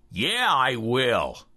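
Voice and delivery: very slow, grudging reply; brother-in-law. A narrator's reading. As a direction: drag it out, eyes narrowed. Brother-in-law with narrowed eyes.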